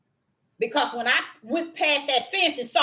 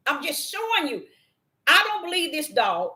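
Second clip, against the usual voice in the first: ordinary delivery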